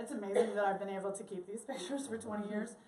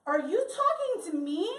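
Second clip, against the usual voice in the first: mildly raised, indignant question; a touch of affronted disbelief; offended challenge; 6 semitones higher, 4 dB louder than usual. Retold or imitated. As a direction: lightly act a touchy comeback. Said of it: "you're like".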